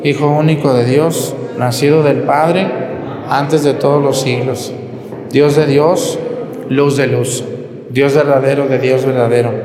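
steady, measured prayer recitation; recited creed. Acted. Priest leading the faithful in the Creed, measured recitation. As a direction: lead the congregation through the Creed.

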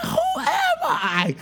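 very high, drawn-out wail; mock tearful. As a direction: wail it in exaggerated tearful disbelief. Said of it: "with tears in my eyes".

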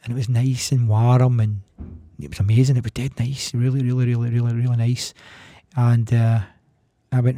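ordinary talk

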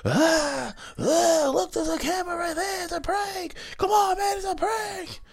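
very high, loud, crying, panicky plea; mock terrified prankster crying out that it's only a prank; mock panicked pleading. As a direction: squeal like a prankster begging not to get hit. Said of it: "a lot of 'em was just like".

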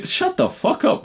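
high, drawn-out disbelieving snap; exasperated outburst. Acted. Fed up with lazy excuses, high exasperated snap. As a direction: blow up at them in disbelief. Ranting at imagined complainer.

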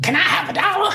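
very high, squeaky begging voice; a wheedling oddball on a train; crazy beggar. Acted. Crazy stranger begging Jeff for a dollar, squeaky high voice. Crazy man begs for money.

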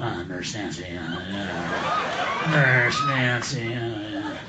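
low, soft mock moaning; aroused Pee Wee. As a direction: imitate a man privately getting turned on. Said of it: "an adult theater going".

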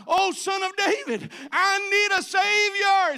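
desperate, drawn-out plea at full voice. Retold or imitated. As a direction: blind beggar shouting over the crowd for Jesus. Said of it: "they were crying out".

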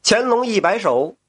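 ordinary delivery

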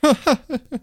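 high burst of laughter; loud laugh. Real, not acted. Laughing at the joke.

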